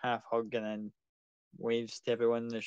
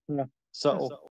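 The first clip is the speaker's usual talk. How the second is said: dry sarcasm; 3 dB louder, slower than usual. Acted. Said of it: "The guy laughs and goes".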